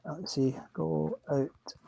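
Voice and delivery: breathless whisper. Real, not acted. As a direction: whisper it under your breath. Whispered aside.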